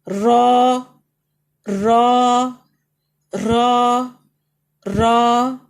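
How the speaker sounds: رو loud and full, mouth open upward; heavy letter. Shown as a demonstration. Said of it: "اوپر کی طرف جائیں گے".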